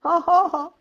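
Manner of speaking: high mock kookaburra cackle, ho ho ho; kookaburra laugh. Shown as a demonstration. Showing a panicking woman how to laugh like a kookaburra. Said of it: "just pretend to be a kookaburra".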